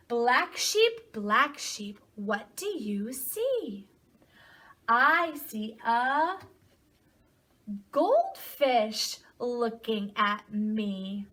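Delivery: slow, lilting read-aloud with stretched words; storybook reading to children. A narrator's reading. Teacher reading a picture book to children, slow singsong storytelling. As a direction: read slowly, swooping up on key animal names.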